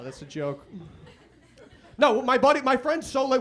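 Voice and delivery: ordinary talk, nothing marked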